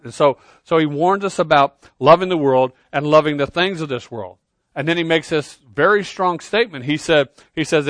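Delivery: usual speaking voice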